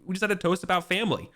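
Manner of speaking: a lightly voiced young knight rushing his point. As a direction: blurt out the grievance quickly. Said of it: "Yvain's like".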